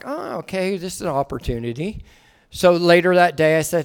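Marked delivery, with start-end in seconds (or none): 0.0-2.0 low, hushed note to self; softly register the thought, pitched low; musing aside. Retold or imitated. Father noticing his son's slip, musing quietly. Father privately notes teaching moment.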